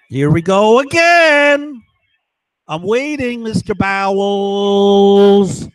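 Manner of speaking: high, drawn-out singsong call; mock calling out. Man taunts Mister Bowles from his house, calling out. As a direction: call out loudly, stretching the name long.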